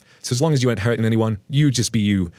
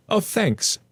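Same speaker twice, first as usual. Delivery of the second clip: polite thanks; 12 semitones higher than usual. Retold or imitated. Narrator voicing herself politely.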